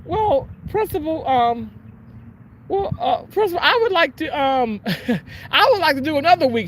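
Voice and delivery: high, syrupy schoolgirl voice; sweet-talking Helga. Retold or imitated. Helga asking the principal for another week, high and sugary. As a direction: coo it to the principal. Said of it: "And she says".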